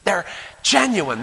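usual speaking voice

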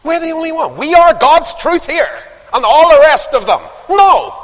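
high, loud mock churchman; pompous caller. Church caller phoning the preacher, pompous. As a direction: mimic the pushy caller. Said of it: "only it was stronger".

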